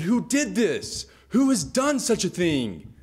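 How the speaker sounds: outraged goddess. Acted. Ceres demanding who cut her sacred tree, outraged. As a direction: demand the culprit like a wronged goddess.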